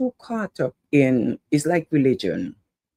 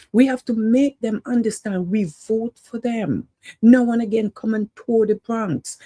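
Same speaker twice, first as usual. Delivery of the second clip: preaching to the crowd; 7 semitones higher than usual. Real, not acted. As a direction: rouse the community like a street preacher. Fired-up appeal about the Bronx.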